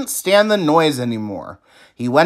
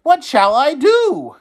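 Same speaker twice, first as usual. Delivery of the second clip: distressed Oscar; 14 semitones higher, 6 dB louder than usual. A narrator's reading. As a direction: cry out in frustration. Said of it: "he cried".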